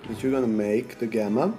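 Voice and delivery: ordinary delivery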